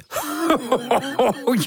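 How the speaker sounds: hearty laugh; jolly burst of laughter. Acted. Wise father bursting into jolly laughter. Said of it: "barst hij in lachen uit".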